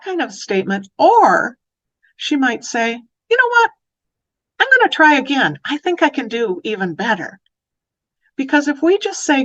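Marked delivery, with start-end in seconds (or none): 3.3-7.4 put-on high, louder child voice, fired up; a girl eager to try once more; determined little girl. Child voiced deciding to retry.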